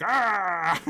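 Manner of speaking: high, loud, drawn-out "ah"; hammed-up yell with a laugh behind it; mock holler. Villain falls flat on his face, mock hollering. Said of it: "he kinda, like, hollers".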